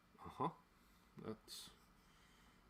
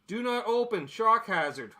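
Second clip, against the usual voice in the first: mock warning read; 8 semitones higher, 6 dB louder than usual. Reading warning label aloud.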